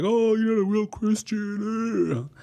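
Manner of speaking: high, slow snooty church lady; mocking churchgoer. Judgmental audience member scolding him, snooty high voice. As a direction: sneer it like a judgy churchgoer. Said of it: "some of you are gonna be like".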